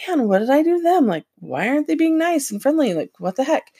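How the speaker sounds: replaying her own hurt puzzlement, lightly played; mock offended. Retelling her old offended reaction to unfriendly people, mildly indignant. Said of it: "I would really be offended".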